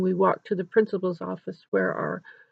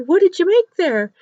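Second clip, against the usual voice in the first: high, loud friendly question; interested principal; 10 semitones higher, 8 dB louder than usual. Retold or imitated. Principal asks a little girl what she made, interested. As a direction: ask a child with raised, lively interest.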